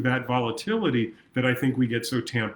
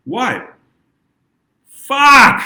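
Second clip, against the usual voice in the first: sudden explosive yell, much higher and louder; 12 semitones higher, 13 dB louder than usual. Shown as a demonstration. Therapist showing clients how anger wants to sound, an explosive yell. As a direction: let the anger blow out in one shout. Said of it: "We wanna be yelling out loud".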